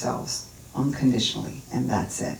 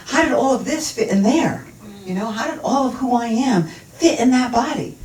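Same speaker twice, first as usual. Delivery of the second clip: high, incredulous wondering; retold astonishment; 7 semitones higher than usual. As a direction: puzzle in wonder, pitched up high. Retold wonder looking down at her body.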